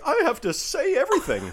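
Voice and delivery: very high, loud mock outburst; hammed-up oversharer, close to laughing; overanimated lady. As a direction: blurt it in a frantic high voice. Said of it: "you're like".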